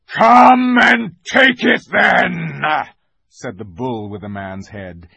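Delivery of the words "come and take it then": slow, full-force bull's roar; great bellow. A narrator's reading. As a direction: bellow a slow, high challenge. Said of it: "in a great, bellowing voice".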